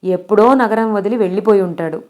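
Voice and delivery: ordinary delivery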